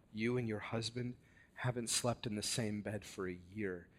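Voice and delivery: ordinary talk, nothing marked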